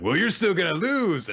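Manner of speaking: high, loud imitated comeback; mock defiant yell. Doubt telling his opponent he'll lose, mock defiant yell.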